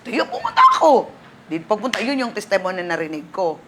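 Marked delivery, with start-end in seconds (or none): 0.1-1.1 high, bright burst of resolve; excited squeal. Retold or imitated. (mataas na tuwang sigaw)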